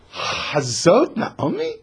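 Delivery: high, slow mocking gasp of disbelief; gossiping women mocking Naomi. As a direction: sneer it out in fake shock. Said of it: "they're mocking her".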